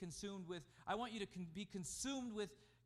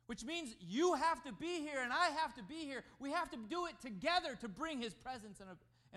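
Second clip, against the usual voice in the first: loud, higher preaching push; full-force preaching; 8 semitones higher, 9 dB louder than usual. Real, not acted. Preacher urging church to gather, loud. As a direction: drive it home to the congregation.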